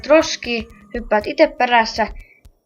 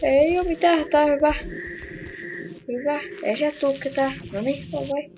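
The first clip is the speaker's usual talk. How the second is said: hushed but higher, hurried; tense urging; 3 semitones higher, 8 dB quieter than usual. Real, not acted. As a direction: nervously push yourself on.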